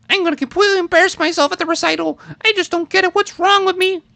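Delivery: put-on high girl voice. Dubbing the anxious pianist girl, high girl voice. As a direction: voice the girl's lines up high. Dubbing worried girl's lines.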